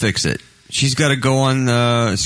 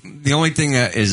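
ordinary talk

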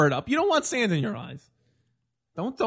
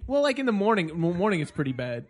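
ordinary delivery

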